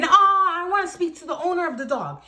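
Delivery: high and fast, pushy demand; demanding lady. Retold or imitated. Neighbor lady demanding the owner, high pushy mimicry. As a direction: mimic an entitled woman insisting.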